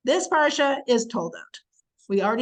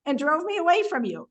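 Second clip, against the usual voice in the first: higher, louder indignant retort; blunt accusing Isaac; 7 semitones higher, 3 dB louder than usual. Retold or imitated. Isaac confronting the Philistine king, higher indignant tone. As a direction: throw it back at them, offended. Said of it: "this really non-diplomatic language".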